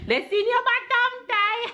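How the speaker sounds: high laughing teasing. Real, not acted. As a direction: tease through laughter. Laughing at son's bum.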